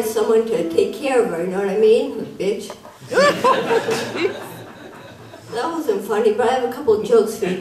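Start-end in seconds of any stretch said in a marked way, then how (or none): 3.0-4.6 high laugh. Real, not acted. Laughs at her own joke.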